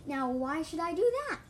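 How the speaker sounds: loud, high defiant question; hissing. A narrator's reading. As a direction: challenge them with a mild edge. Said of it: "the voice hissed".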